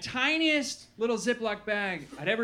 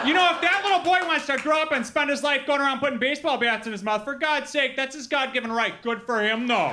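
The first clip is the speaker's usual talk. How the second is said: put-on Wisconsin accent; 4 semitones higher than usual. Wisconsin local defending the little boy's right, Wisconsin accent. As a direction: play an indignantly approving Wisconsin local. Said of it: "say anything in a Wisconsin accent".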